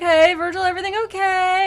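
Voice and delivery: high sugary Dante checking on Virgil; sweet mock concern. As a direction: chirp it like a worried pal. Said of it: "Dante's like".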